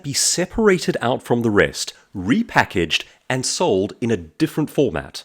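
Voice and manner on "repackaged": ordinary talk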